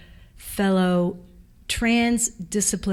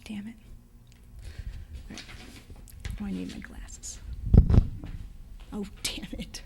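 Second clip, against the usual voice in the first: very soft, half-whispered grumble; real off-hand self-talk, barely audible; muttering to oneself; 7 dB quieter than usual. Real, not acted. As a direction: curse under your breath while hunting for something. Fumbling to find quote, self-talk.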